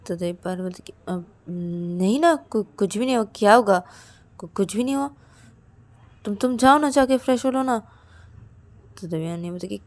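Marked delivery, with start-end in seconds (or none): 1.1-7.8 stammering, higher brush-off; nervous friend denying anything is wrong; hesitant denial. Acted. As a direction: stumble over the words as if hiding something. Payal evades the question.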